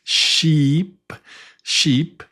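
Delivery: sheep with a long, stretched E. Shown as a demonstration. Teacher repeats sheep for the class. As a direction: draw out the E in sheep. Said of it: "Like in sheep".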